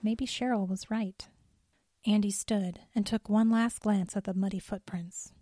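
ordinary talk

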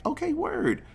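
high, light put-on voice; mock easygoing agreement. Boyfriend letting her go to the restroom, high easy agreement.

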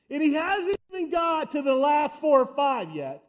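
ordinary talk, nothing marked

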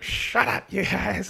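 high mock-scolding mom voice; exasperated mom. Mom answering the teasing family, mock exasperated. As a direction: imitate a mother hushing the family. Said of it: "my mom would be like".

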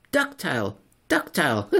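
high, chuckling pun delivery; high giggly joke voice; laughing punchline. Acted. Silverbug delivers his pun.

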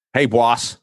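put-on New York accent. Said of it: "I'm working on the New York accent".

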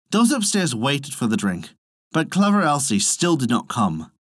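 ordinary delivery, nothing marked

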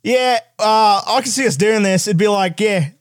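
broad mock Australian accent. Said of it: "then the Australian person responding".

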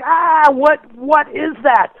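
high, loud startled yell over engine noise; retold scream. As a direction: shout it like a scared kid at a roaring dragster. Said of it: "I'm screaming like".

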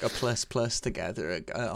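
ordinary delivery

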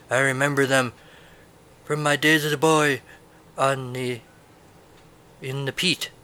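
lightly put-on Scottish brogue, old sailor reminiscing; a hint of a Scottish old-timer, rolling it out; Scottish accent. Reviewer slipping into a mock Scottish old-timer, light Scottish accent. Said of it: "That was my Scottish accent".

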